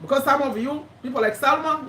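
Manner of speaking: ordinary talk, nothing marked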